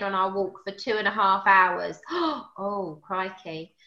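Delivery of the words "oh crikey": a soft stunned gasp then oh crikey; gasping mock shock. Host reading a listener's question, softly aghast. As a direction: pretend to be shocked at the news. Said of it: "I'm, like, animating as I'm reading".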